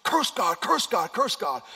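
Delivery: goading devil. Acted. Devil urging Job to curse God, loud goading. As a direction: egg them on loudly as the devil. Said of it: "and the devil's saying".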